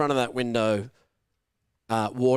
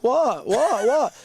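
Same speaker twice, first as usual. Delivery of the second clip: high, louder feigned confusion; mock puzzled; 11 semitones higher, 5 dB louder, slower than usual. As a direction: play dumb over the hose.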